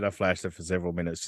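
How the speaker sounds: low, quiet creepy imitation; mimicking the caller. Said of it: "back to where he said".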